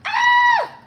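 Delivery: very high, loud joyful shriek; excited shriek. Real, not acted. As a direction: let out a thrilled scream. Just got booked for show.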